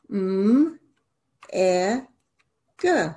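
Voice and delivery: m, e, g as separate letter sounds; sounding out letters. Shown as a demonstration. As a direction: sound out m, e, g slowly for a child. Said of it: "sound out each syllable".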